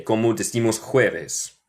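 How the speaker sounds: ordinary talk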